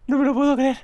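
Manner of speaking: high, tearful disbelief; crying with joy. Real, not acted. Player stunned by luck, tearful disbelief. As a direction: say it through happy tears. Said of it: "estoy llorando".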